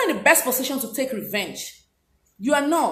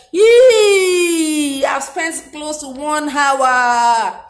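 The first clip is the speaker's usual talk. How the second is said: real shocked wail at running late; dismayed surprise; 4 dB louder, much slower than usual. Real, not acted. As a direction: cry out at the time gone, stretching it. Surprised at time spent on video.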